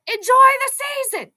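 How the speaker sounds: high, loud excited cry; calling out. Real, not acted. Calls out over game.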